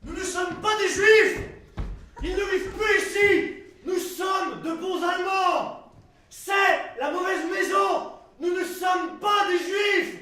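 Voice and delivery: high, slow, drawn-out yells; desperate shouting. Acted. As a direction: bellow each sentence slowly to those outside. Crient vers dehors pour se disculper. (cris lents, aigus, à pleine voix)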